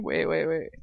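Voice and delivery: ordinary delivery, nothing marked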